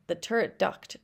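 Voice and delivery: usual speaking voice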